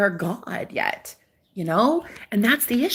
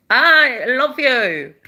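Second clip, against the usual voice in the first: tender; 4 semitones higher, 4 dB louder, much slower than usual. Real, not acted. Tender address to viewer.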